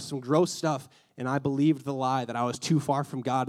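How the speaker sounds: ordinary delivery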